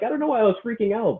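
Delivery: high, bright mock enthusiasm; mock cheerful client. Coach mimicking a client who now loves jump squats, high mock cheer. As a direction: squeak it out like a giddy convert.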